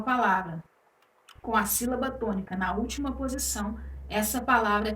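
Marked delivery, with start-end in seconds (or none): none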